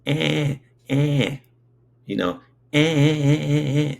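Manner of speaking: put-on high, loud baby 'eh eh' noises; a baby trying to talk, calling out 'eh'; baby babble. Baby in the crib calling to wake his parents, high babble. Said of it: "it's just coming out like".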